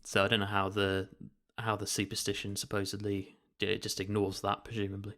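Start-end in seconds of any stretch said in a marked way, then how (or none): none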